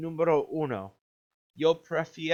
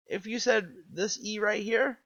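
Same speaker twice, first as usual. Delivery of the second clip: usual speaking voice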